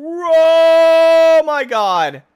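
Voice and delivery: high, loud, slow drawn-out cry; panicked wail. Real, not acted. Gamer wailing as the car tumbles, high and loud. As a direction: wail as you fall.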